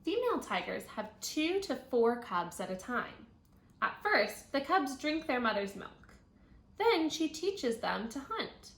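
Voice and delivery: bright, clear read-aloud voice; reading to children. Acted. Teacher reading a tiger book to her class, clear read-aloud.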